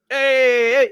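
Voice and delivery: a lightly mocked Italian "hey"; a hint of a fake Brooklyn Italian; bad Italian accent. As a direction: imitate a poor Italian tough guy. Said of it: "He doesn't even sound Italian".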